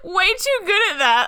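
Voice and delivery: ordinary talk, nothing marked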